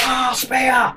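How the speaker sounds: high, strained yelp of pain; mock pained cry. Godzilla speared by Titanosaurus, a high mock yelp. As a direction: yelp it out like a hit monster. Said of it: "He's like".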